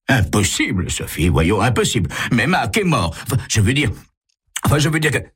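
high, stumbling denial; flustered protest. A narrator's reading. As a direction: protest in a fluster, tripping over the words. Cornered impostor protesting in confusion.

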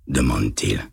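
ordinary delivery

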